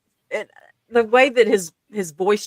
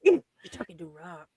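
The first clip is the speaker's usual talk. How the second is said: tough guy voice; 3 semitones lower, 11 dB quieter than usual. Retold or imitated. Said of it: "his tough guy voice".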